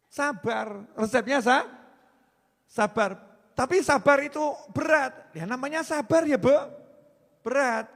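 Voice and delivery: loud, high plea about patience; preacher driving home patience; fiery preaching. Acted. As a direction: press the question on the audience.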